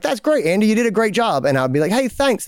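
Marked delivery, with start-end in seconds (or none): 0.0-1.4 high-pitched, chipper praising boss; upbeat praise. Pretend. Boss congratulates employee on the new system, upbeat praise. 1.9-2.4 high, fake-chipper thanks; put-on cheerful thanks. Employee answers the boss's praise, put-on cheerful thanks.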